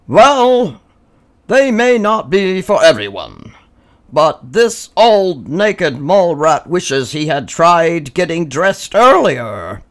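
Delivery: high, loud, slow and grand; regal proclamation. A narrator's reading. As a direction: address the colony like royalty. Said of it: "Grandpa's proclamation".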